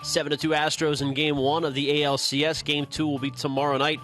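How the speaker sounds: ordinary talk, nothing marked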